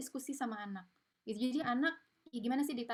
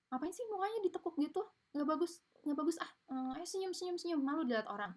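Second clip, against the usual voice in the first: put-on higher, nagging scold of a sulky child; strict parent mocked; 7 semitones higher than usual. Strict parent nagging a sulking child, higher scolding voice. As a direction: play the bossy parent telling the kid to smile. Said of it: "Kalau orang tua authoritarian".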